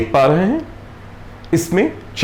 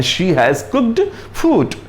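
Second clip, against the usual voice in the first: very slow, word by word; slow example read; 4 semitones higher, much slower than usual. Shown as a demonstration. Teacher showing the perfect tense example, very slow. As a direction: spell the sentence out slowly for learners. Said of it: "आप देख पा रहे हैं".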